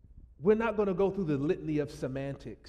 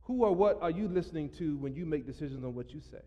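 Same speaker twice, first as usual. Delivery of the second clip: ordinary talk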